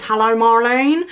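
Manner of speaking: slow Only Fools and Horses greeting, rising at the end; drawn-out cheeky Only Fools and Horses impression; Only Fools and Horses accent. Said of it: "talks like he's from Only Fools and Horses".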